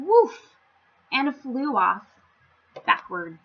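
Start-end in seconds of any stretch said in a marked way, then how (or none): 0.0-0.6 high, loud mock woof; a bird barking like a dog; bird's bark. Silly bird says woof, high and loud. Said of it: "bird ... said".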